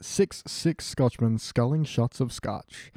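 slow, careful tongue twister. Shown as a demonstration. Trying a tongue twister for friends, slow and careful. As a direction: pick through each word slowly. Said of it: "Now say it with a German accent".

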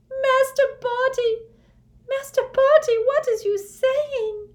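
very high, loud wail in a small creature's voice. A narrator's reading. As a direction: wail it out in horror at your master. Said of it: "wailed Blinky".

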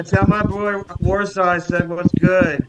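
normal talking voice

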